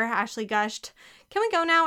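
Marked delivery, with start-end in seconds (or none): 1.3-1.9 higher, louder impatient question. A narrator's reading. Boy eager to leave, asking impatiently. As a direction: hurry them out the door. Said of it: "Eddie asked impatiently".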